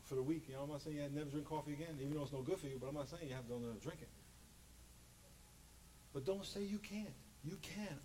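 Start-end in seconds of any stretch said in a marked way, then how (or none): none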